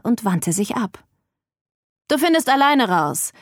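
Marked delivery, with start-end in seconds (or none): 2.1-3.3 higher, offhand call; called over shoulder. A narrator's reading. Man dismisses the visitor as he leaves, called over his shoulder. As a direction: toss it back while walking off. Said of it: "rief er mir über die Schulter zu".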